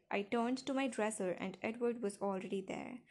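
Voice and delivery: ordinary delivery, nothing marked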